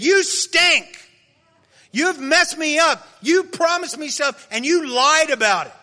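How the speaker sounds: loud, high mock rant at God; retold yelling at God. A man retelling how he yelled at God in prayer, loud and furious. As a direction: yell it up at God, betrayed and furious. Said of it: "got mad at God and yelled at him".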